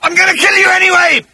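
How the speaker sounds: very high, loud, furious roar. Acted. Agrajag vowing to kill Arthur regardless, furious roar. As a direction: roar the threat at full pitch. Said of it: "he roared".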